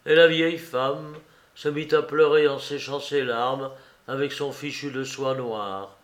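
ordinary delivery

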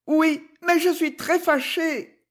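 high, hushed-toned quick reply; distressed heroine, pitched far up; upset young lady. Acted. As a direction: protest quickly in a high, thin little voice. Rosa distressed, answers lawyer.

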